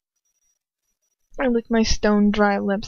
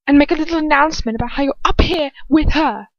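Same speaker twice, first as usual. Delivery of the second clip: high, sing-song threat; menacing taunt; 7 semitones higher, 4 dB louder than usual. Acted. Bellatrix threatens to expose Lucius, mocking and high. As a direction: savor the blackmail.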